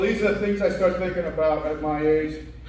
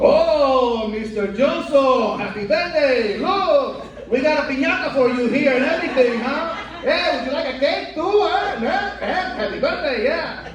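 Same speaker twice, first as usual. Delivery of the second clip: high, frantic party-host voice with laughs; overexcited host pushing cake and piñata; 7 semitones higher than usual. Retold or imitated.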